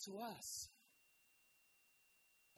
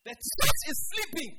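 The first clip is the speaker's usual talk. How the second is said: big shouted rebuke, higher pitch; preaching shout; 5 semitones higher, 17 dB louder than usual. Real, not acted. Preacher crying out over his church, shouting. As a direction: blast it at the whole church.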